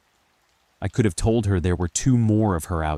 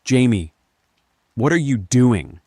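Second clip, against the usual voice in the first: higher, louder, nervous question; 6 semitones higher, 7 dB louder than usual. A narrator's reading. Second Linda peeks into the kitchen, nervous. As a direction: call out with nervous alarm. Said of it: "she asked nervously".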